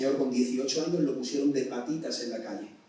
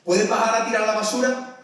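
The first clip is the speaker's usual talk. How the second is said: parent's orders; 7 semitones higher, 6 dB louder than usual. Retold or imitated. Preacher voices a parent calling chores, loud orders. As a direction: call out chores like a mum.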